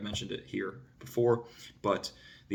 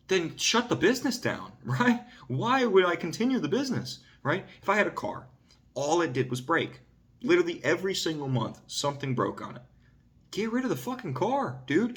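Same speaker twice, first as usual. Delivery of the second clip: fired-up, incredulous venting; exasperated rant; 8 semitones higher than usual. Real, not acted. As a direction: get worked up like it's obvious. Exasperated rant about useless things.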